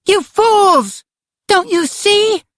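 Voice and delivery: put-on very high, desperate child's cry. A narrator's reading. Karen pleads with her attackers, crying out. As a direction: cry out in a piercingly high little-girl voice. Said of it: "Karen cried".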